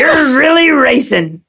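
high, hyped ride announcer car. Pretend. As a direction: pump up the rider like a talking car. Repeats car ride line in voice.

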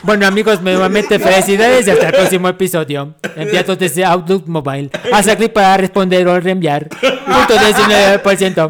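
loud commanding general reading. Acted. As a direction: announce it like an army general. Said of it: "que lo lea el general".

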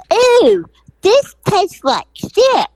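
a high, loud "Ooh" of disgust, then a slow complaint; disgusted customer. Retold or imitated. A customer tastes the dip and squeals in disgust.

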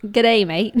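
loud go at an Australian greeting; amateur Aussie accent; attempted Australian accent. Shown as a demonstration. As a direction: have a stab at sounding Australian.

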